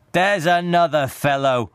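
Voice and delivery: ordinary delivery, nothing marked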